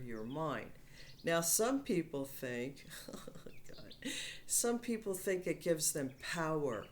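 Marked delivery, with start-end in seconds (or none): 3.0-4.1 breathy, laughed-out aside under the voice; laughing aside. Real, not acted. Speaker breaking up mid-explanation about some people, laughing. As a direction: let a soft laugh swallow the words.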